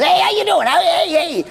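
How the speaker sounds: imitating Mickey Rooney. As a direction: breeze onto the set greeting everyone. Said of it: "and he goes".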